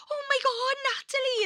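very high, loud attempt at Welsh; bad Welsh accent. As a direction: squeal it in a badly imitated Welsh accent. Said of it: "with this really Welsh accent that I can't do".

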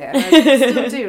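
laughing while saying it. Real, not acted. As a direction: laugh through the words. Laughing admission of still loving it.

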